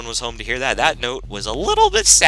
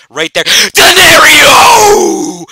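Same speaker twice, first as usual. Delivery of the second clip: loud, high, slow celebration cry; roaring play call; hyped shout; 14 semitones higher, 11 dB louder, much slower than usual. Real, not acted. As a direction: cheer the catch like a stadium.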